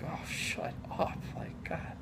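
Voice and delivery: hushed, breathless mutter of annoyance; whispered aside. Retold or imitated. Grumbling at the screaming kids, whispered. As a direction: whisper it under your breath, slowly.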